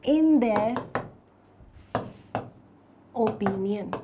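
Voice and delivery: word by word with long pauses; slow dictation. Real, not acted. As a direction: dictate it slowly as if writing it out. Writing out an example sentence slowly. (धीरे-धीरे, एक-एक शब्द रुककर लिखवाते हुए)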